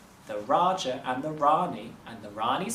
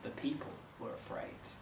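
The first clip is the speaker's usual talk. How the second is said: ordinary delivery, nothing marked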